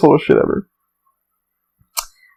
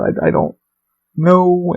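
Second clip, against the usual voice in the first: normal talking voice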